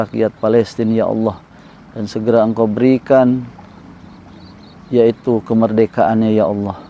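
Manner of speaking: low, measured pleading to God; subdued, devout dua with soft endings; solemn prayer. Real, not acted. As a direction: lead a congregation in earnest supplication, voice held low. Leads a prayer.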